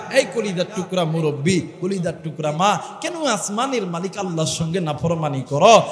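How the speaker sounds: ordinary talk, nothing marked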